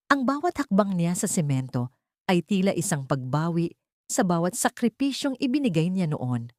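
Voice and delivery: ordinary delivery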